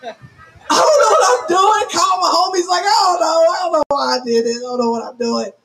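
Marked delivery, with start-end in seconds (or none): 0.0-1.9 hammed-up tearful wail; mock crying. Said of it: "I called my husband crying". 2.8-5.5 hammed-up tearful moan, sinking away; mock crying. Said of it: "I called my husband crying".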